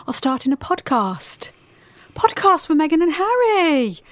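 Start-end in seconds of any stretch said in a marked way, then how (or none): 2.1-4.0 mock excitement. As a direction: announce it like a thrilled fan.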